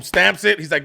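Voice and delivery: ordinary talk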